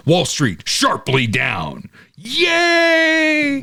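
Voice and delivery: slow headline bursting into a high cheer; mock gleeful. Wire service rooting for a market drop, mock gleeful. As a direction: announce bad news, then squeal a hammed-up celebration. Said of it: "They just can't wait to get out there".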